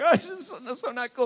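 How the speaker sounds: put-on high, loud whining young man; mock whine. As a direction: whine like an embarrassed teen. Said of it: "I was like".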